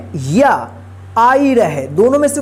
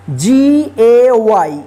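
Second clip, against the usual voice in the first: letters spelled out slowly one by one; slow spelling; 3 dB louder, much slower than usual. Shown as a demonstration. Teacher spells word letter by letter.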